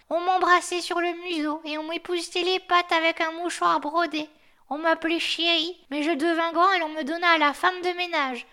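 put-on the old dog remembering his pampered youth; old guard dog reminiscing. The chained dog tells the snowman his life story, in a high, loud put-on voice.

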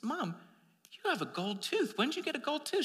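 high, amazed question from a daughter; a touch of a startled girl spotting gold; astonished daughter. Retold or imitated. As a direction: daughter gasps at mom's gold tooth, surprised. Daughter spots mother's gold tooth.

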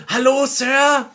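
high, loud hail; calling after someone. Acted. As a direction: call out to catch a stranger's attention. Ruft einem fremden Mann hinterher. (laut hinterhergerufen)

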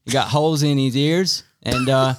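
a hint of a US accent, playful; mock American accent. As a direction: hype man in a put-on American voice. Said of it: "we're going American".

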